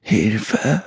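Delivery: understated screech with last strength; a spent, strained shriek, held back in volume; weak shriek. A narrator's reading. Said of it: "kreischte sie mit letzter Kraft".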